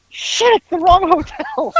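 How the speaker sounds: high, slow squawk of realization; mock dismay. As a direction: imitate the driver discovering his blunder. Imitating driver realizing the mistake.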